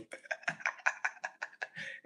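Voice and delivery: quiet, voiceless wheezing laugh; long run of soft breathy chuckles. Real, not acted. Amused at provoking the robot, quiet wheezy laughter.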